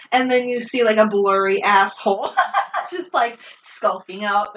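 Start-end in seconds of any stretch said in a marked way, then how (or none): none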